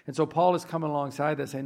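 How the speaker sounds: ordinary delivery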